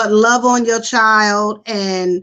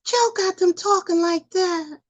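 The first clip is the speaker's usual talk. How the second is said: put-on a light, soft, higher child voice; mock high voice; 8 semitones higher, 5 dB quieter than usual. Shown as a demonstration. Showing how Joe left the kids sounding, high and soft. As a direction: lift into a small childlike voice. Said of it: "don't really talk like that".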